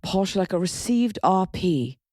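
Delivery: slow, posh British delivery; posh RP accent. Shown as a demonstration. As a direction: put on Received Pronunciation, drawn out. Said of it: "What about posh?".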